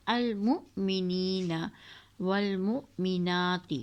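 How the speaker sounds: المؤمنین والمؤمنات slowly with a hamza jolt; slow recitation with catch. Shown as a demonstration. Teacher repeating Quran words with the glottal jolt. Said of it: "جھٹکا دیتے ہیں".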